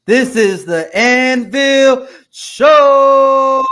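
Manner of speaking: big, high, very slow announcer roar; grand entrance announcement. Acted. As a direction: boom out the show title. Announces show name grandly.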